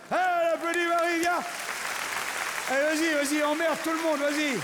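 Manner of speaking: showman's excited applause call; cheering on. Real, not acted. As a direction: pump up the crowd for Marie. Cheering volunteer onto stage.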